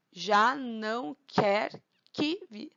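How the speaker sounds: "Já não quer que viva" slowly split into syllables; deliberate, slow scansion of a verse. Shown as a demonstration. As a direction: stretch and separate each syllable for counting. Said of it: "Um, dois, três".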